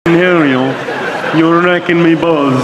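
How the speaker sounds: slow, slurred-together north Dublin accent; deaf-sounding north Dublin accent. Shown as a demonstration. Comedian showing his best one, deaf-sounding north Dublin accent. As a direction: do north Dublin as if you can't hear. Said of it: "All you've got to do is sound deaf".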